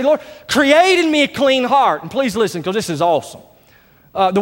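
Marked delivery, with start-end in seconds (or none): 0.0-2.0 higher, slow, pleading "create"; fervent prayer. Retold or imitated. Preacher urging the church to pray, fervent and higher. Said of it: "You just need to say".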